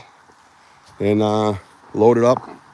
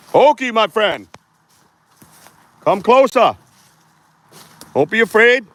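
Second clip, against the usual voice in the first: loud high call into the woods; 13 semitones higher, 4 dB louder than usual. Real, not acted. Bigfoot hunter calls loudly into the forest.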